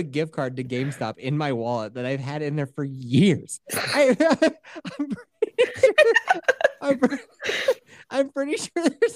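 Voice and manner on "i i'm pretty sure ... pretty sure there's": laughing through words; giggling while talking. Real, not acted. Laughing at own GameStop card.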